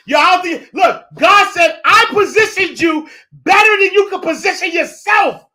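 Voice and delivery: soaring, shouted God quote; booming, high-pitched declaration of God's words; loud preaching. Acted. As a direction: belt it out like a preacher at full force. Preacher erupts quoting God.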